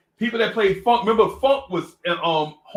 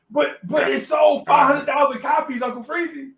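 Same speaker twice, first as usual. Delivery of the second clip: loud, high-pitched mock protest; indignant protest; 10 semitones higher, 6 dB louder than usual. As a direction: imitate an incredulous musician arguing back. Musician protests to young narrator.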